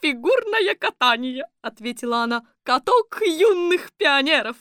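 high, loud sporty granny; brisk old lady. A narrator's reading. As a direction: answer like an energetic grandma. Sporty grandmother answers.

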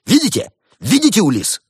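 high, loud outburst; triumphant accusing yell; indignant cry. A narrator's reading. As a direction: burst out shouting, far above normal pitch. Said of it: "Барсук вскочил и выкрикнул".

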